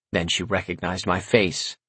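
usual speaking voice

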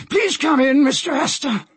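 very high-pitched captain voice; courteous invitation, pitched well up; captain character. Acted. Captain invites passenger into cabin, high voice.

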